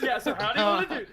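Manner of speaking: loud, high, fast through real laughter; laughing. Real, not acted. Player joking to the table about a kill, laughing. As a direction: crack up while rushing the words out.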